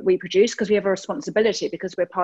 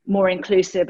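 ordinary talk

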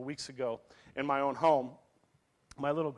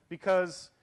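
usual speaking voice

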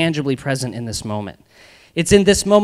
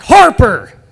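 sharp loud shout of a name; teacher's yell; 8 semitones higher, 10 dB louder than usual. Retold or imitated. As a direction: bark the name across the gym. Teacher yelling at student in story.

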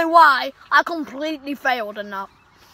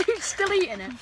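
normal talking voice

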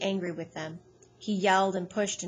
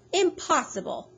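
a high, sharp cry of disbelief; shocked cry; 8 semitones higher, 3 dB louder than usual. A narrator's reading. Mercedes protesting the tent be left behind, high shocked cry. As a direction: throw the word up like your hands. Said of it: "Mercedes cried".

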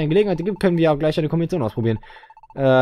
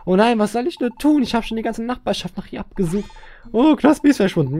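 high, hammed-up fretting mum; distraught mother; 9 semitones higher than usual. Mother searching for her missing child, mock-distraught. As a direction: wail lightly like a worried game character. Voices worried mother in the game.

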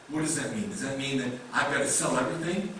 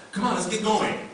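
high, urging dog-walker voice; upbeat call to get moving; 7 semitones higher than usual. Acted. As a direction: chivvy the dog along, pitched up.

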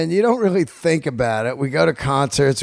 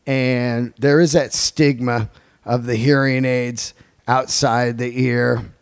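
ordinary delivery

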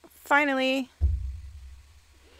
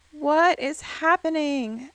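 normal talking voice

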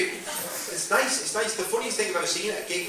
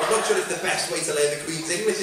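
ordinary talk, nothing marked

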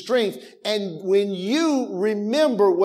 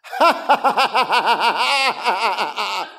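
retold laugh; 2 semitones higher than usual. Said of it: "I just started laughing".

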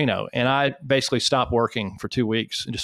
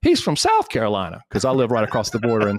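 Southern assistant correcting. Retold or imitated. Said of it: "And she says".

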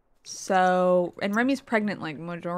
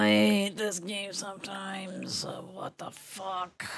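slow, breathy whispered complaint; frustrated whisper; 4 dB quieter, slower than usual. Real, not acted. Streamer whispering her frustration at the game. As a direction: hiss it under your breath.

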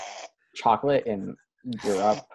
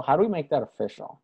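ordinary talk, nothing marked

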